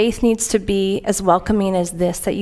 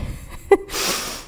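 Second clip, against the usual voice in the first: soft breathy laugh; 5 dB quieter than usual. Real, not acted. Laughs at her own joke.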